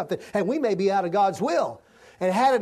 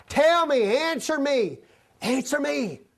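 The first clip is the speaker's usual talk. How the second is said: voice of God pleading; 8 semitones higher, slower than usual. Acted.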